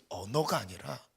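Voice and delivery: usual speaking voice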